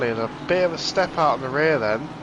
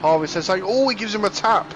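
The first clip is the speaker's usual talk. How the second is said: excited race call; 6 semitones higher than usual. Real, not acted.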